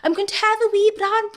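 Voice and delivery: high, loud Scottish granny impression; hammed-up, thrilled Scottish accent. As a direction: imitate an excited Scottish mother. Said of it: "like, Scottish accent".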